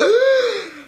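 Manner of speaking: sharp high gasp of delight; loud delighted gasp; excited gasp. Real, not acted. Excited reaction to movie.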